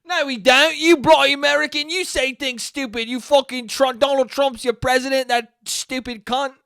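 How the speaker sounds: put-on high, loud indignant British accent; Lin-Manuel Miranda mock Cockney. Mock Brit scolding an American, high loud British accent. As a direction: squawk back like an offended Brit. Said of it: "That's my Lin-Manuel Miranda impression from Mary Poppins".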